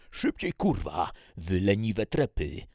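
loud, commanding officer's yell; barked order shouted over roaring engines; shouting major. A narrator's reading. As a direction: bellow at the lazy troops over engine noise. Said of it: "wrzeszczał major Benson".

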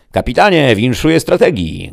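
high, loud congratulations; congratulating. A narrator's reading. Chief gadoid congratulating the captain, loud and high. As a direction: hail the captain loudly. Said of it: "gratulował naczelny gadoid".

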